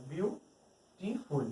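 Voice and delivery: slow বিউটিফুল, split apart with a gap inside; syllable by syllable. Shown as a demonstration. Teacher showing a word takes several breaths, বিউটিফুল split slowly. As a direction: break বিউটিফুল into bew... ti-ful, unhurried. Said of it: "তিনবার নিঃশ্বাস নিয়ে উচ্চারণ করা গেল".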